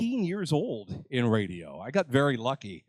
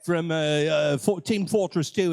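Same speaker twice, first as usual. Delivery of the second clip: lightly voiced Australian sniper; playful mock Australian accent. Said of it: "he talks like an Australian".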